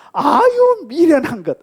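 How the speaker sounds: high, loud exasperated scolding; mock scolding parent. Preacher imitating a parent scolding a foolish child, high and loud. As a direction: tut at a child like a fed-up mother.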